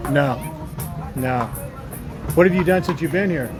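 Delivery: usual speaking voice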